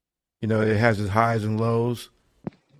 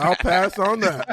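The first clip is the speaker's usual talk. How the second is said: laughing; 7 semitones higher, slower than usual. Real, not acted. Laughing refusal repeated.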